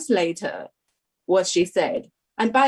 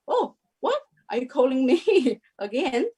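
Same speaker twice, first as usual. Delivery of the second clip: startled disbelief; 5 semitones higher than usual. Retold or imitated. Retelling her reaction to hearing her name again, much higher and astonished. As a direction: pitch leaps much higher on a stunned "oh, what?", with a laugh. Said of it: "I'm like".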